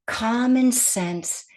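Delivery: ordinary talk, nothing marked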